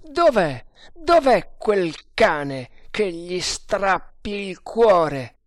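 loud, high, slow-stretched demand; enraged yelling. A narrator's reading. Freed captive hunts for his enemy, yelling. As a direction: roar the question in a rage. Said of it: "urlò Tremal Naik con voce strangolata".

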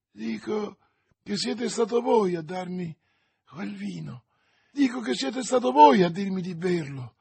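high, insistent charge, climbing in pitch; anguished accusation. A narrator's reading. Dying soldier accuses D'Artagnan about the wine, anguished insistence. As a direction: hammer the accusation home in agony.

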